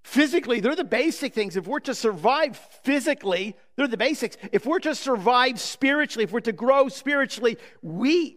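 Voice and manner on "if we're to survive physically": ordinary delivery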